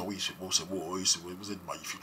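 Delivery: soft, low mimicry of European French; mock whitened French accent. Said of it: "on aura beau wattiser".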